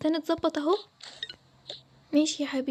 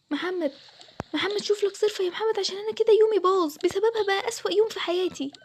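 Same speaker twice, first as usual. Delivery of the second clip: yelling at Mohamed; 5 semitones higher than usual. Acted. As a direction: shout for him to deal with his sister. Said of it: "انتي بتزعقي لمين كده؟".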